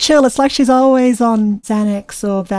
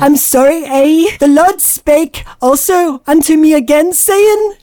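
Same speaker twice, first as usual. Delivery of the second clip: badly faked Canadian sliding into Irish; apologetic Canadian; 2 semitones higher than usual. Sister retries the accent for scripture, bad Canadian sounding Irish. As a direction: put on a hammed-up Canadian apology. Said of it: "apologize like a Canadian".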